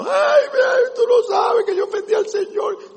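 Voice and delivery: high, loud sing-song mock wail. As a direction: parody a sinner wailing his repentance. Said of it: "No es llorando". (lamento burlón, agudo y fuerte)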